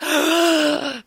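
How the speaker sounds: sharp put-on gasp; mock shocked gasp. Reader showing how we are supposed to react, mock shocked gasp. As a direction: gasp as if scandalized.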